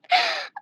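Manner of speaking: a sudden gasp jumping way up in pitch; sharp high gasp. Real, not acted. Reacting to his own gross instructions, sharp high gasp. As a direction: gasp in mock horror.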